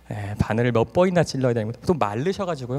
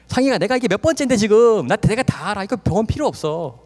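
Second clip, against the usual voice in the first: raised, louder indignant refusal; stubborn father; 8 semitones higher, 5 dB louder than usual. Retold or imitated. Father refusing hospital care, indignant. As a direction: insist you know best.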